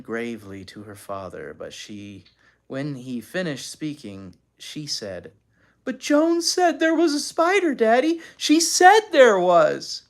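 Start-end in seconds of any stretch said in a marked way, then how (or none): 5.8-10.0 put-on high, loud child's protest; protesting little girl. A narrator's reading. Anne arguing with Daddy, protesting. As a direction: insist on it in a raised little-girl voice. Daughter protests to her father.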